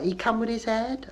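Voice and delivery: high questioning Bristol lilt; statement that rises like a question; mother at the clinic, high rising Bristol voice. Retold or imitated. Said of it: "They usually go and say things like".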